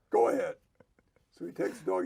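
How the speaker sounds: ordinary talk, nothing marked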